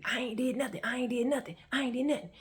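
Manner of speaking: repeated denial. Retold or imitated. Imitating him denying it over and over, insistent. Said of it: "after saying repeatedly".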